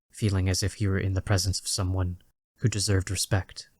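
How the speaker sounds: ordinary delivery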